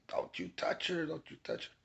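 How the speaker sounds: high, hushed imitation of screaming; mock scream. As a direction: scream it under your breath.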